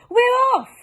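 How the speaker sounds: very high, loud joyful cry; the crew cheering as the peach moves; joyful shout. Acted. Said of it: "the others cried".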